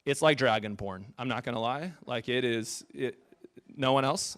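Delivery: ordinary talk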